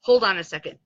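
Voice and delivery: ordinary talk, nothing marked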